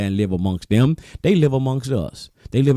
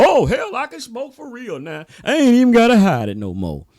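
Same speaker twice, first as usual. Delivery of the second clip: high, mock-thrilled voice; overjoyed smoker; 11 semitones higher than usual. Preacher mocking people glad weed is legal, high excited mock voice. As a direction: squeal it like a kid let off the hook. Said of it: "You just so happy".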